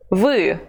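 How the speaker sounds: "Vous" with a clear rounded U; "Vous" said as voo, never E; U sound. Shown as a demonstration. Teacher showing learners the U sound in "Vous". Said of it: "Pay attention to the U sound".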